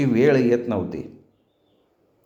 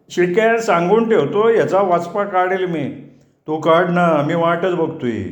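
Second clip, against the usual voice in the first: quarrelling threats; 5 semitones higher than usual. A narrator's reading. Threat and dare after the fight.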